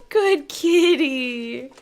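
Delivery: ordinary delivery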